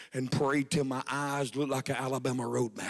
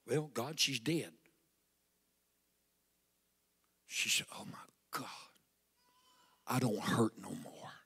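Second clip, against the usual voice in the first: much softer retelling; preacher quietly recalling the healing; hushed; 5 semitones lower, 8 dB quieter than usual. Acted. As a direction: tell the miracle almost in a hush. Hushed telling of miracle.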